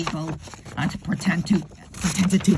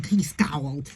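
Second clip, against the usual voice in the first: usual speaking voice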